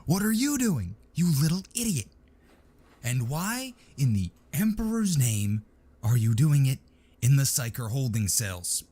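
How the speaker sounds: high, furious sergeant yell; enraged chewing out. A narrator's reading. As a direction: tear into the idiot. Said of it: "enraged non-com embarked on ... epic chewing out".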